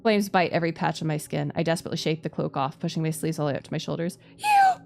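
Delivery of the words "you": high, loud accusing yell; furious outburst; angry shout. Acted.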